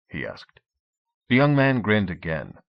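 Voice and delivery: ordinary delivery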